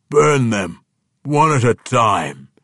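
loud orc warlord's command; commanding order. A narrator's reading. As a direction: order the execution, slowing toward the end. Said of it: "he ordered".

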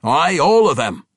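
loud, high, boisterous dwarf bellow; a hearty dwarf calling out in delight; gruff dwarf. Acted. Boisterous dwarf Athrogate greeting his king, loud and gleeful.

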